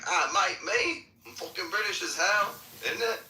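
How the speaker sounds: high, indignant put-on British accent; hammed-up British protest; fake British accent. Pretending to be British when called out, fake British accent. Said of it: "talk in a British accent".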